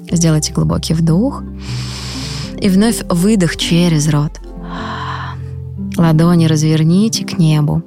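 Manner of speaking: soft, slow meditation voice; calm guide talking them into rest; guided meditation. Acted. Leads a guided breathing meditation.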